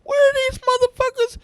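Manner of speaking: mock-bewildered high squeal; mock searching. Teller acting out looking for his accusers, high and squeaky. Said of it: "I'm like".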